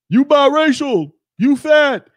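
high, loud taunting voice; mocking trolls. Mocking what trolls always say.

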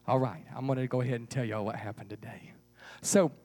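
ordinary delivery

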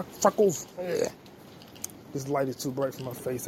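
usual speaking voice

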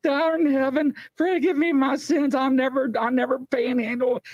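high-pitched mock prayer; desperate praying. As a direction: imitate a frightened man praying up a storm. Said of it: "this guy is praying up a storm".

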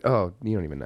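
ordinary talk, nothing marked

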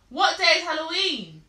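repeating to voice assistant. Real, not acted. Slow clear question to assistant.

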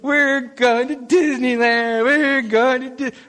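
put-on high, excited childish chant; excited kids. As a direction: gush like eager kids.

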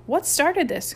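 high, urgent question; frantic cry. A narrator's reading. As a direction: demand it in a panic. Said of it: "cried Chip frantically".

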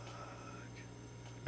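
soft whispered curse. Retold or imitated. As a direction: breathe it out, caught out. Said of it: "And I was like".

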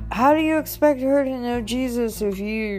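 high, mimicked reproach; mock-exasperated question in a high voice; reproachful friend. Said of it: "he goes".

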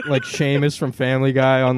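normal talking voice

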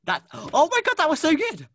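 very high, loud squeal of praise; amazed delight. Real, not acted. Reacting to co-host's accent attempt, amazed squeal. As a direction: shriek with thrilled surprise.

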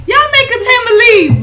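high, loud retort. Retold or imitated. Laughing at friends.

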